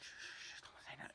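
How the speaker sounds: whispered plea to keep quiet; urgent shushing. Retold or imitated. Teller shushes the friend who caught him, urgent whisper. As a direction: shush them under your breath, acting it out.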